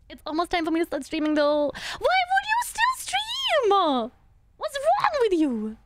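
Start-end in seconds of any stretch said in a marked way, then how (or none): 1.7-5.8 drawn-out shocked high scold; high slow disbelief. Real, not acted. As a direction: stretch it out, aghast. Scolds game character streaming.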